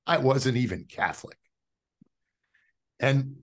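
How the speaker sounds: normal talking voice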